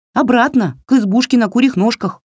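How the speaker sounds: high, quick doll voice; high-pitched, hurried little doll; bossy doll. A narrator's reading. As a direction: rattle off the order briskly, high. Doll gives the plan.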